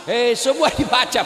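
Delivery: loud laughing outburst; laughing exclamation. Real, not acted. Preacher laughing at the congregation's absurd reading habits, loud laughing. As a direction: burst out laughing as you protest.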